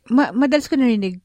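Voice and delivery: usual speaking voice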